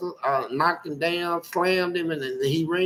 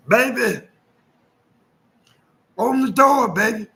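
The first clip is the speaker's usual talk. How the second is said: put-on higher, whiny drunk begging at the window; drunk husband pleading; 7 semitones higher, 4 dB louder than usual. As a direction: plead to be let in, slurring.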